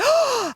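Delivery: sharp high gasp of shock. Retold or imitated. Kid hearing a playground secret, gasping high. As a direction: gasp in disbelief.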